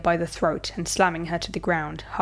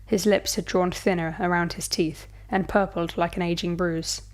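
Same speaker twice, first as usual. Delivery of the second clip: usual speaking voice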